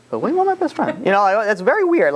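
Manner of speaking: high, baffled question from the king; incredulous husband. Retold or imitated. Jealous king questioning his wife, high incredulous voice. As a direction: pitch it up in disbelief. King puzzled by wife's invitation.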